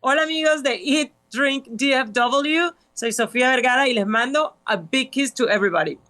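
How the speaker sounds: bright, high shout-out to fans; high, lively celebrity hello; cheerful greeting. Real, not acted. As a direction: greet the listeners like a star sending kisses. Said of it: "I have a surprise for you".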